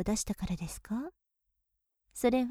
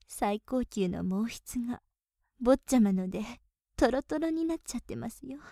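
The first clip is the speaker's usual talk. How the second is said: ordinary talk, nothing marked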